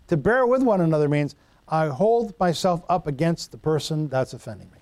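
ordinary delivery

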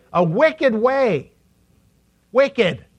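wicked said loud and slow; stress on wicked. Shown as a demonstration. Preacher prompts the congregation to say wicked. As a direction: lean hard on wicked. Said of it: "Say it".

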